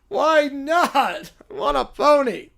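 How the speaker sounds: put-on high, loud, bratty little boy; whiny little kid. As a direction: demand it like a spoiled preschooler. Kid demands a pony.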